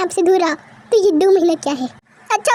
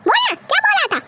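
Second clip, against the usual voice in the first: high, sharp demand; angry challenge; 10 semitones higher than usual. Acted. Mother challenges son to repeat himself, angry. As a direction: dare him to speak up.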